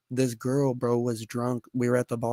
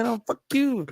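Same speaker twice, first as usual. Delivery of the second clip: squeaky retort; 11 semitones higher than usual. Retold or imitated. Acted high squeaky retort.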